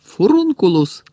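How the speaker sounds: high, loud, slow spell cry; shouted spell. A narrator's reading. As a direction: hurl the curse out. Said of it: "крикнув Гаррі".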